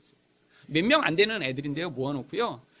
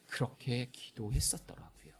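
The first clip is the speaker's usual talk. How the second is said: slow, near-whispered realisation; hushed recollection; 7 semitones lower, 14 dB quieter than usual. Real, not acted. Preacher recalls praying that as a child, hushed and slow. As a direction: let it sink almost to a whisper.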